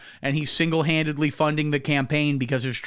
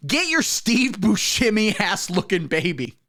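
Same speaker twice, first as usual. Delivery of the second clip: higher-pitched mock dismissal, punchy; mock bouncer yell; 6 semitones higher than usual. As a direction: turn the baby away at the door. Bouncer yelling at baby.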